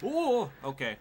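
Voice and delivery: startled gasp. Real, not acted. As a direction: caught off guard by a sudden crash. Car suddenly flips.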